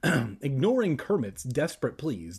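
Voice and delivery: usual speaking voice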